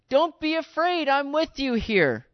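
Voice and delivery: high, slow, comforting call; slow down and reassure them from up high; urgent reassurance. Retold or imitated. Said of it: "He's kinda going".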